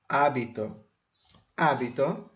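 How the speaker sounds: "hábito" stressed on the opening Á; stress on first syllable. Shown as a demonstration. Spanish teacher reads out an example word, stress on the first syllable. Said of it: "o acento prosódico e o acento escrito está no A".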